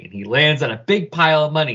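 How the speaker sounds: ordinary talk, nothing marked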